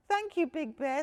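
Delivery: high, loud little cub voice; a small bear cub thanking sweetly. Acted.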